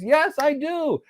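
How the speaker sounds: lightly voiced eager router; proud router. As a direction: answer like an eager little machine. Router proudly confirms direct connection.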